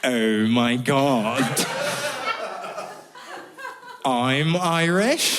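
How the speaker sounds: slow, stretched-out English gushing; mock English accent. English person reacting to meeting someone Irish, slow English accent. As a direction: drag it out in an exaggerated English accent. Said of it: "to English people, you guys freak out".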